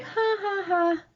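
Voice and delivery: high, soft fake laugh; mock laugh. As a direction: imitate laughing off a suggestion. Retold dismissive laugh at dad.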